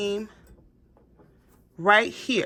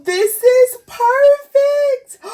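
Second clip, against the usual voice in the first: much higher, louder and drawn out slowly; ecstatic; 8 dB louder, much slower than usual. Real, not acted. Gushing to viewers over the print, slow ecstatic high squeal. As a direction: stretch each word in delight. Admiring the finished tumbler.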